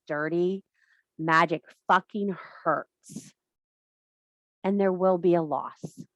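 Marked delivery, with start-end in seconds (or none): none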